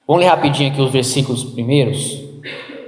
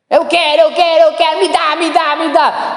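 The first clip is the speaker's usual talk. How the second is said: much higher whiny repeated demand; child tantrum; 12 semitones higher, 3 dB louder than usual. Pretend. Spoiled child demanding dessert from dad, high whiny tantrum. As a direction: play a kid throwing a fit. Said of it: "a criança começa com a tal da birra".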